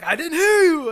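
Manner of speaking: high, loud yell back in mock protest; defensive worker. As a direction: imitate a flustered worker arguing back.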